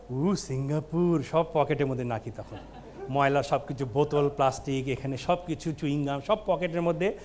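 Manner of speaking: low, hushed mock reverence; mock awe. Comic showing Bengalis meek in Singapore, mock awe. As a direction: act meekly impressed, voice dropped low.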